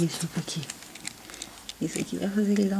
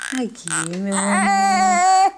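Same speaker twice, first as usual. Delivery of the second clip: cooing; 5 semitones higher, 4 dB louder, slower than usual. Real, not acted. Mother cooing to baby.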